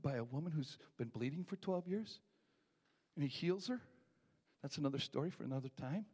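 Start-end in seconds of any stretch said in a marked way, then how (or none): none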